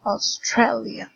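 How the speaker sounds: Australia, slowly, stressing "stra"; slow teaching model of Australia, weight on "stra"; stress on second syllable. Shown as a demonstration. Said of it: "the stress is in stre".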